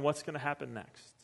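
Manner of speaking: soft hushed confession of a tax collector; hushed anxious sinner. Retold or imitated. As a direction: confide it softly, as if afraid to hope. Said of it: "sinners and tax collectors ... are going".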